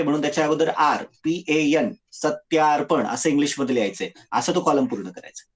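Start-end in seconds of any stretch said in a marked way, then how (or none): none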